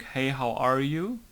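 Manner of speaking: ordinary talk, nothing marked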